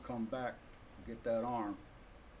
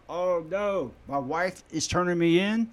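mock panic; 5 semitones higher, 8 dB louder than usual. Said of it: "And I'm in there".

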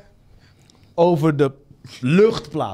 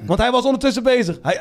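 ordinary delivery

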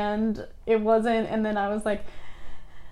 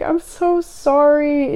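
slow, louder, higher retold apology; emphatic apology; 4 semitones higher, 6 dB louder, much slower than usual. Student retelling apology to crying visitor, emphatically remorseful. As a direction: stretch it out with heartfelt emphasis.

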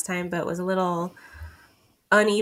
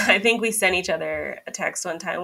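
ordinary talk

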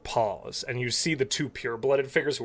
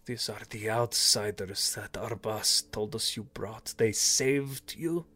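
lightly voiced Naga; 4 dB quieter than usual.

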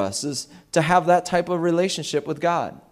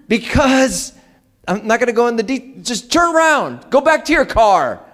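high, raised-voice urgent command; exasperated order; 9 semitones higher, 4 dB louder than usual. Retold or imitated. Father ordering his daughter back to her car, exasperated. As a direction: burst out high, cutting yourself short to insist.

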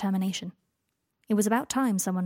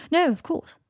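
much higher, hurried-to-agree reply; brittle agreement; 8 semitones higher, 4 dB louder, slower than usual. A narrator's reading. Draco answering Harry's awkward apology, brittle agreement. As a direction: agree too readily.

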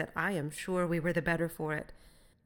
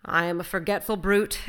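loud self-reproaching exclamation; exclaiming; 2 semitones higher, 7 dB louder than usual. A narrator's reading. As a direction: exclaim at your own thoughtlessness. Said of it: "exclaimed Will".

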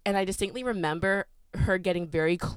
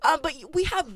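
mock indignant; 11 semitones higher, 7 dB louder, faster than usual. Real, not acted.

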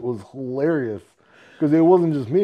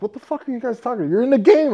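surprised panic; 10 semitones higher, 3 dB louder, faster than usual. Retold or imitated. Panicked retort in retold game.

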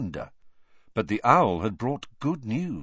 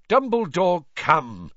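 sharp, raised rebuke; angry; 9 semitones higher, 5 dB louder, slower than usual. A narrator's reading. Fudge rebuking Dumbledore angrily. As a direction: scold him, annoyed. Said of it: "said Fudge angrily".

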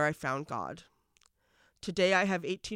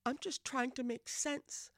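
a hurt woman's higher, hushed plea to God; broken woman pleading; 7 semitones higher, 7 dB quieter than usual. Acted. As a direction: plead with God like a lost child, holding back tears.

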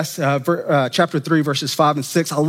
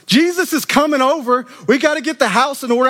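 hurried Martha; 7 semitones higher than usual. Retold or imitated. Voicing Martha's panic.

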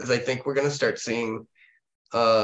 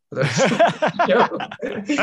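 laughing; 11 semitones higher, 6 dB louder, slower than usual. Real, not acted. Laughing at losing his thought.

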